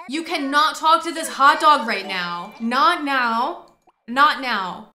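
frantic shout at the hot dog interrupting; loud scolding. Real, not acted. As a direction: yell at the screen to stop him.

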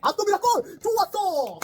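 high, loud goal yell; ecstatic shout. Real, not acted. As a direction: scream with joy at the goal. Reacting to rival goal. (기뻐서 지르는 환호)

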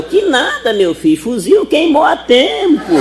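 put-on high, loud old granny reply; squeaky old woman answering; old lady voice. Velhinha responde na piada. (voz de velhinha aguda e alta)

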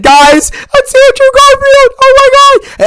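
high-pitched screaming at Andrew Garfield; excited scream. Retold or imitated. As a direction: shriek it out like a thrilled fan. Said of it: "I screamed".

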